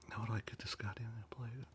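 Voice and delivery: whispered aside to self; whispering. Real, not acted. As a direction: mutter it under your breath.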